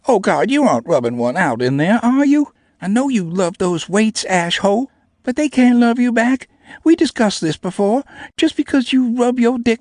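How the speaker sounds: high, loud mocking small girl. A narrator's reading. Namie mocking the narrator about the weights, high and loud. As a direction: tease him mercilessly in a high voice.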